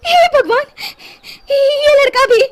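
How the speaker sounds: high, loud alarmed cry; panicked mother. Acted. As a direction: gasp out in sudden alarm. Mother finds son missing. (अचानक घबराकर, ऊँची ज़ोरदार आवाज़ में)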